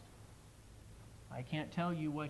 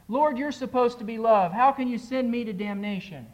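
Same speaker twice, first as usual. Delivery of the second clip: anguished protest; 6 semitones higher, 10 dB louder than usual. Acted. Soul protesting to Jesus.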